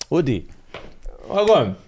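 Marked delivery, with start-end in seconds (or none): none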